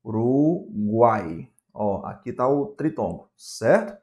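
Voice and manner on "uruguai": "Uruguai" very slow, split into syllables; teacher slowly sounding out "Uruguai"; drawn-out sounding out. Shown as a demonstration. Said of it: "na palavra".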